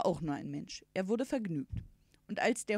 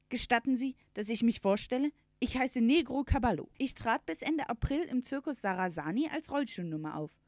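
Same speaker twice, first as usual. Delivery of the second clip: raised, formal character voice; talking horse introducing itself; 5 semitones higher, 4 dB louder than usual. A narrator's reading. Horse presenting itself to its hosts, formal introduction. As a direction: announce yourself formally, pitched up.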